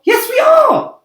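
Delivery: very high, loud, near tears; shrieking cry. A narrator's reading. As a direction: scream it in panic. Characters bicker in panic.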